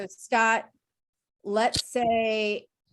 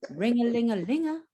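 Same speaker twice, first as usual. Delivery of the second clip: sung-out ring-a-ling-a-linga; phone ring imitation; 4 semitones higher than usual. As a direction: sing the ringtone like a phone. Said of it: "So one, two, three".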